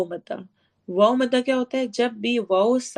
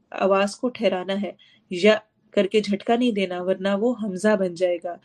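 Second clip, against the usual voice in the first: ordinary talk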